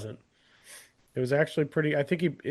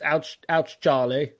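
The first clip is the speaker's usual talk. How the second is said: mock British child; slower than usual. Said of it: "So it's just".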